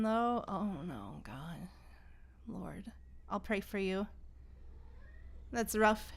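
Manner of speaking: ordinary talk, nothing marked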